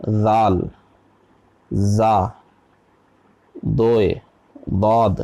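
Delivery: ذال، زا، دوئے، ضاد each said alone, with a pause after it; distinct letter articulation. Shown as a demonstration. Teacher showing students four similar Quranic letters, ذال، زا، دوئے، ضاد articulated separately. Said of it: "چاروں حروف کی آواز الگ ہے".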